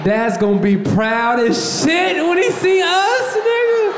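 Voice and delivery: slow, building to a high excited shout; comic jubilant shout, rising sharply; elated boast. Acted. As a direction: swell with pride until you're yelling. Boy proudly imagines dad's approval.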